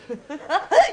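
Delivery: high laugh; amused laugh. Acted. Inspector laughs at his own squeamishness. Jokes about being squeamish.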